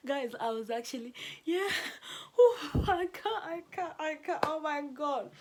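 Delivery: high, loud, helpless laugh-cry; laughing through tears. Real, not acted. Retelling the acting scene to viewers, laughing and teary.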